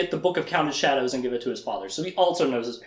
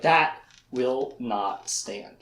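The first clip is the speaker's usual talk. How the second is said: slow, lower, word by word; grave resolve; 4 semitones lower, much slower than usual. Acted. Richard refusing to be trapped, slow grave resolve. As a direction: declare it with weighty pauses.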